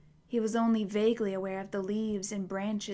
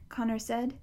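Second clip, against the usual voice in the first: plain reading voice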